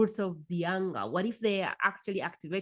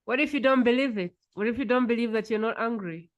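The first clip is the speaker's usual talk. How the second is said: normal talking voice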